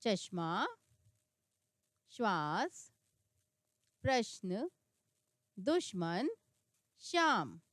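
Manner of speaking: each word said alone, clearly; careful teacher reading with pauses; word-list reading. Shown as a demonstration. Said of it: "आधा अक्षर इस तरीके से लिखा जाता है".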